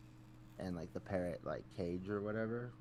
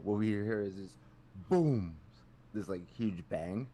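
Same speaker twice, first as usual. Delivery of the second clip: ordinary talk, nothing marked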